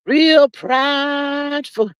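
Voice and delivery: "Real prideful" repeated slow and heavy; slow drawn-out repeat. Shown as a demonstration. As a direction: drag "Real prideful" out very slowly. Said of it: "They have been real prideful".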